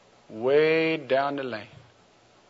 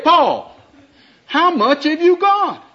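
very high, loud, exasperated; Michelle's voice; 13 semitones higher, 5 dB louder than usual. Acted. Michelle demanding Paul name his money, very high and loud. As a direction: push the question up high in mock desperation.

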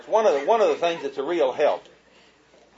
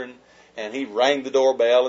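usual speaking voice

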